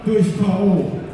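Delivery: booming ring announcer, stretching the winner's name; boxing ring announcer. Acted. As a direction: announce the champion to the arena.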